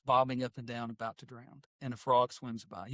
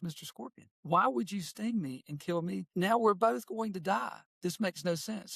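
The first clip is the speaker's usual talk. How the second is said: higher, slow, drawn-out mock plea; dying frog; 6 semitones higher than usual. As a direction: lightly act a stung frog questioning its killer. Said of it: "he's about to go paralyzed".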